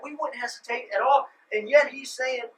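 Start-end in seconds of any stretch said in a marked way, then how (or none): none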